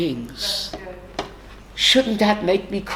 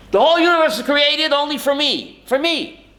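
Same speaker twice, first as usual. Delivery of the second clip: proclaiming quote; 7 semitones higher than usual. Retold or imitated. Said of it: "the Mishnah says".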